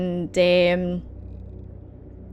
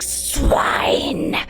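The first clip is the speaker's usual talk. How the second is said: hoarse, drawn-out guttural cry; riled-up demon; 5 semitones higher, much slower than usual. Acted. Goat blocked by an invisible barrier, riled-up rasp. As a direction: rasp the insults slowly and furiously. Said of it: "he's, he's really riled up".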